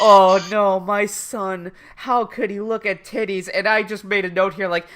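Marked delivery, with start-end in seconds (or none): 0.0-3.5 mock-dismayed father, slightly raised; disappointed dad. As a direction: lament like a let-down dad, lightly acted. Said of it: "you've got disappointed dad".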